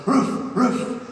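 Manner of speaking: words barked like a dog; high woofed answer, two short barks; talking dog. Acted. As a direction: bark the word out as a dog. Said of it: "And Rover says".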